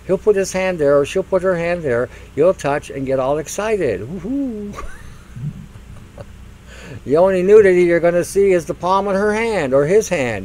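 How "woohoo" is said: mock whoop. Mock excitement again.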